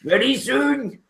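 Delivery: high ogre repeat, cut short. Acted. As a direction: snap ready soon like a hurried ogre. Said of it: "the ogre looks up and says".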